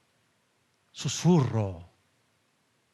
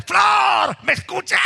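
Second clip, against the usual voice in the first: much higher, much louder mock yell at the wife; pretend shout; 14 semitones higher, 8 dB louder, slower than usual. Husband shouting at his wife beside him, comic over-the-top yell. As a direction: bellow it across the table in jest. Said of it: "comienzo a gritarle".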